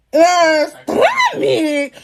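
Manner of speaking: high, shouted disbelief; mock horrified outburst. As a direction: cry out in hammed-up horror. Retelling horror at being cut.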